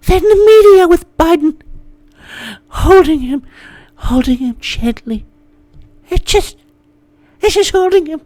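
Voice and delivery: sappy mock tenderness for a fragile baby; mock baby talk. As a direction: coo it like cradling a newborn. Mocking media coddling Biden.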